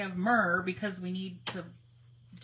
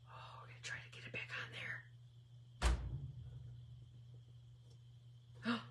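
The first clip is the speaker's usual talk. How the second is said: quick anxious whisper; hurried whisper while concentrating; fast whisper; 12 dB quieter, much faster than usual. Real, not acted. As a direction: rush it out in a whisper. Tense stamping attempt.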